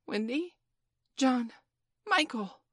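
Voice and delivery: put-on high, questioning call to each child; calling names. A narrator's reading. Peter calls the children by name.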